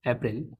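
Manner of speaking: अप्रैल said softly as "epril", light rise at the end; model pronunciation. Shown as a demonstration. Teacher to class, softly modelling अप्रैल. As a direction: repeat अप्रैल quietly for the class. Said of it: "ये होता है अप्रैल".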